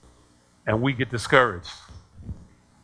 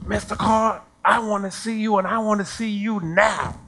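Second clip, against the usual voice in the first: high-pitched mock yell; retold screaming parent; 7 semitones higher than usual. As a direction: imitate an irate parent's demand, pitched up. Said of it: "screaming at me at the top of their lungs".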